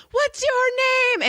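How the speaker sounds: put-on high, slow kid's question; imitating the kid asking the ignoring dad; high child's voice. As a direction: draw it out high like a small kid. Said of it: "And the kid's all".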